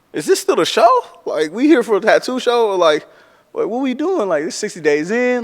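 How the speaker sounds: panicking out loud in a high, loud voice; retold alarmed outburst. While the guard searches his luggage, loud high alarm. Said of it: "I'm like".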